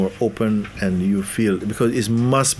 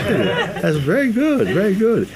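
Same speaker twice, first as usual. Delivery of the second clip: delighted reply; 13 semitones higher than usual. Retold or imitated. His own delighted reply.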